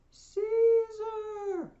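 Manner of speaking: high, drawn-out cry from the crowd; soothsayer's long, loud call; yell. Acted. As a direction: hail a ruler from far back in the throng. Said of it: "But the soothsayer yells out".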